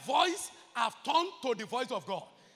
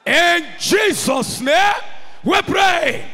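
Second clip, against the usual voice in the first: loud, slow, chanted prayer to the Lord; shouted prayer; 6 semitones higher, 17 dB louder, much slower than usual. Real, not acted. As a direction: cry out each plea slowly and forcefully.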